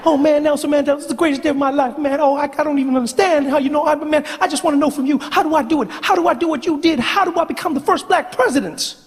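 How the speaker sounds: high-pitched rapid-fire gushing; high, fast young Obama. Acted. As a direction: rush every word up high, no breath. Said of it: "You are speaking so fast".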